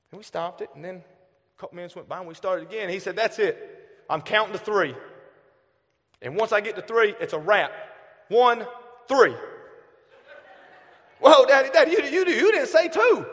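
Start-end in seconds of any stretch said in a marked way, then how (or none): 3.1-9.3 firm, louder count to three; a stern father counting, raised voice; dad counting to three. Retold or imitated. 11.2-13.3 put-on high, loud, laughing kid protest; child outcry, much higher and louder. Kid protesting dad skipped two.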